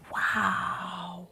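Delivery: whispered amazement; awed whisper. Retold or imitated. Reacting to a photo, hushed wow.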